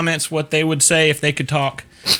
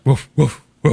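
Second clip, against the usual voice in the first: put-on short woofs of an old dog; imitated dog woof; 4 dB louder than usual. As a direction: bark lightly like an older dog. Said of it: "That's, like, how she sounds".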